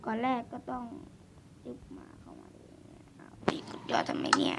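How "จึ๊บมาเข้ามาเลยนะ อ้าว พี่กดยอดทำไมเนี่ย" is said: hushed unvoiced whisper while sneaking. Real, not acted. Gamer sneaking past players, whispering. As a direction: breathe the words out with no voice.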